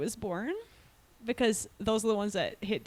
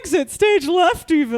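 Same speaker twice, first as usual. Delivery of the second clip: Snagglepuss impression; 5 semitones higher, 9 dB louder than usual. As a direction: ham up the cartoon catchphrase.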